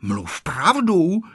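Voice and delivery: high-pitched schoolgirl threat; threatening. A narrator's reading. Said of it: "hrozila mu Tereska".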